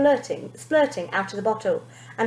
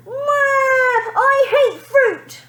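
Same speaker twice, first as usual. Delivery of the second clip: high, loud, slow wail; a tantrum wail of a spoilt girl; 6 dB louder than usual. A narrator's reading. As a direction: wail it like a bawling child. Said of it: "Tandy wailed".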